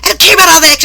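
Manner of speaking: high loud snap; mock boss snapping. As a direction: bark it back dismissively. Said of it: "And then she says".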